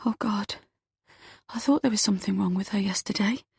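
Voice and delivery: breathless frightened whisper. Acted. Eve whispers to Gregory on the phone, hushed. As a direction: whisper it in shock to her uncle. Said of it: "I whisper".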